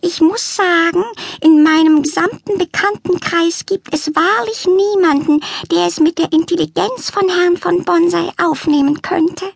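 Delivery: high, prim, put-upon lament; complaining cat lady. A narrator's reading. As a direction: sniff at the disappointing zoo.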